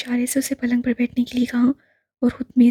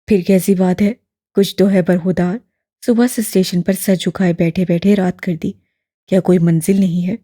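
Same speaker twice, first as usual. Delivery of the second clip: put-on low, louder elderly man, gently probing; lower, fuller old gentleman; old imam voice; 5 semitones lower, 5 dB louder than usual. A narrator's reading. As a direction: voice the old man, kindly and curious.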